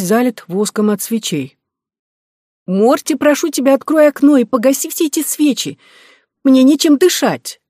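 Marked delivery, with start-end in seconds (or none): 2.7-7.6 put-on high, loud childish pleading; squeaky girl's voice. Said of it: "Меня пугал мой голос".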